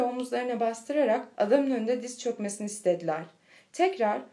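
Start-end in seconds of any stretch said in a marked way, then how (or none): none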